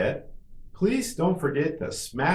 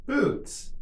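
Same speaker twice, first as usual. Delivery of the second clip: much higher, shorter take; quicker model. Shown as a demonstration. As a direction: say it quickly on a raised pitch. Teacher models word for repetition.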